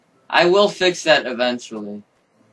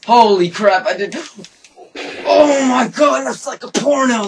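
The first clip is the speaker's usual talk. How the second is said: very high, loud squealing exclamation; excited shock; 11 semitones higher, 7 dB louder than usual. Real, not acted. Gamer seeing a swarm of mobs, loud high excited shriek. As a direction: shriek with amazement.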